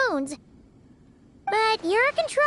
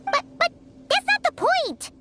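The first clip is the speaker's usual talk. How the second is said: flustered protest; 7 semitones higher than usual. Acted. Paimon protests the weird statue.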